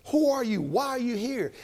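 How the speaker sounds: high, loud startled questions; jolted awake, blurt it out. Retold or imitated. Boaz startled awake, retold.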